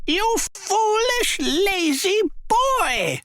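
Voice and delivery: high, slow scolding cry; angry mother. A narrator's reading. Mother scolding Jack for the beans, high angry cry. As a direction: scold the lazy boy, drawn out. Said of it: "cried his mother angrily".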